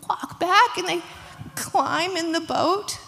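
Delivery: ordinary delivery, nothing marked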